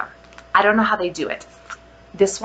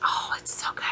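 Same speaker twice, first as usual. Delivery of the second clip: awed whisper; 10 dB quieter, faster than usual. Real, not acted. Sniffing butter pecan, whispering in delight. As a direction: sigh it out in a whisper.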